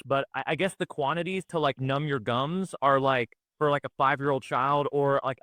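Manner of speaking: ordinary delivery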